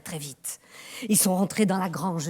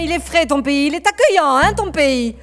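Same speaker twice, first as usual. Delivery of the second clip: loud, mocking complaint about the cold; sarcastic remark; 6 semitones higher, 11 dB louder than usual. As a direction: tease the cold welcome loudly. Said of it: "Antoine gardait pas de dire".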